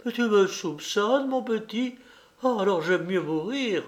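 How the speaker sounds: high, tearful plea through sobs; sobbing nurse. A narrator's reading. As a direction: plead while sobbing, voice up high. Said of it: "elle tomba à genoux et sanglota".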